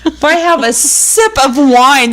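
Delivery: ordinary talk, nothing marked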